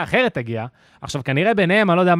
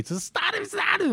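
much higher, mock scolding cry; mock Russian yell; 6 dB quieter than usual. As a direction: squeal it like the furious cleaner. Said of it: "ממש צעקה עליי".

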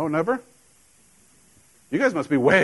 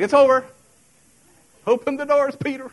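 mock doom; 12 semitones higher, 4 dB louder than usual. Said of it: "I'm like".

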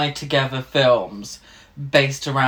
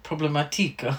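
mock Italian accent; 3 semitones higher, 4 dB quieter than usual. Shown as a demonstration. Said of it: "in an Italian accent?".

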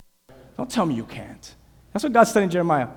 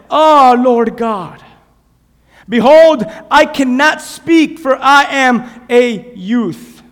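pleading protest; 2 semitones higher, 7 dB louder, much slower than usual. Acted. Young Jeremiah protests to God that he is too young, slow pleading. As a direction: beg to be let off, drawing it out.

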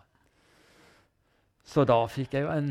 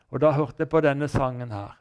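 ordinary talk, nothing marked

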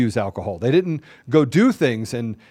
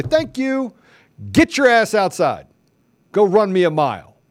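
loud, higher bossy parent; stern parent; 6 semitones higher, 4 dB louder than usual. Pretend. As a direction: bark it like a fed-up parent. Voicing parent to kid.

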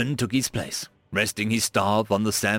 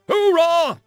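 hoorah yelled much higher and louder; rallying war cry; 7 dB louder than usual. A narrator's reading. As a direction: belt out the battle cry.

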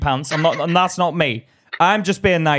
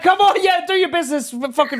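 egging a mate on; 11 semitones higher than usual. Retold or imitated. Teasing a colleague who flirts at work, in a high mock cheer. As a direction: hype your mate up, half joking. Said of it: "but I'd be like".

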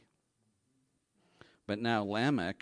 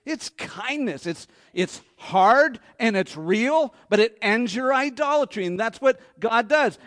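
preaching at full force; 10 semitones higher, 11 dB louder than usual. Real, not acted. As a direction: drive the point home from the pulpit. Preaching climax, loud.

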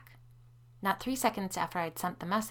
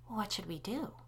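usual speaking voice

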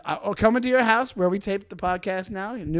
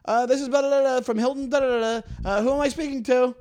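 lightly voiced telemarketer, pitched up; mock sales-call greeting; telemarketer spiel; 6 semitones higher, 3 dB louder than usual. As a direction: imitate a phone rep's rote introduction. Voices the telemarketer introducing himself.

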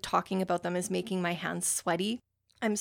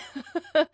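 short, higher-pitched chuckle; self-conscious laugh; 8 semitones higher than usual. Real, not acted. Reader admitting a book always makes her cry, little laugh. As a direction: laugh at your own confession.

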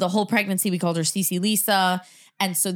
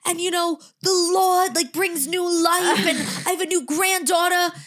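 higher-pitched imitation of a preacher; pastor preaching; 7 semitones higher than usual. Imitating dad preaching about his new granddaughter, pastor preaching. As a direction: proclaim it like a pastor from the pulpit. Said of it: "He was preaching last Sunday".

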